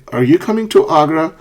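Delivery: "Are you coming to Agra?" asked as a question; yes-no question. Shown as a demonstration. Teacher giving the full question form, yes-no question tune. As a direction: ask it lightly, lifting toward "Agra". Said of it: "You can say".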